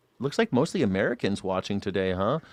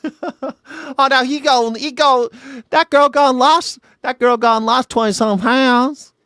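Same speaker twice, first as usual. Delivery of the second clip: high, loud, slow Southern gossip drawl; hammed-up Southern lady gossiping; exaggerated Southern twang, slow; Southern accent; 13 semitones higher, 8 dB louder, slower than usual.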